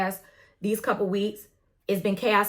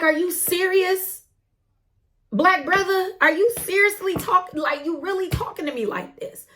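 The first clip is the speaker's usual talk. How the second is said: incredulous; 8 semitones higher than usual. Retold or imitated. Retelling asking the rude driver if he's serious, high and incredulous. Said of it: "I'm like".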